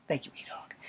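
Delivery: sarcastic whispered thanks; soft ironic whisper. Real, not acted. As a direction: mutter the thanks under your breath, dry.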